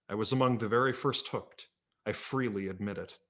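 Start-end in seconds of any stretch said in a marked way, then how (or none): none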